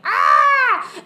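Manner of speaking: high, very loud mock scream. Noomi during the squid baby surgery, very high loud mock scream. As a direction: let out a big scream.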